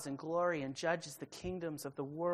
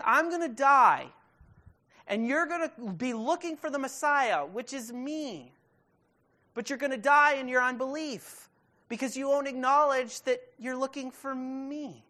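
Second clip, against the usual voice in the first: forceful preaching; 9 semitones higher, 10 dB louder than usual. Acted. Preacher voicing Jesus' warning.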